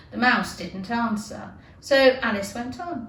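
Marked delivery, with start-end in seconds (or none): none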